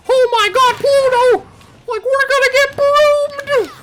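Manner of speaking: very high, loud panicked falsetto; Mickey Mouse. Acted. Cartoon mouse warning his dog as he flees, high panicked falsetto. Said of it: "or rather, he's like".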